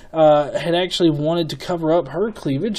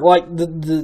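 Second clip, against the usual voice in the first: ordinary talk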